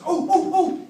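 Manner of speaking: high, loud startled oh oh oh; mock a startled mother spotting something; sudden surprised cry. Mom suddenly sees something.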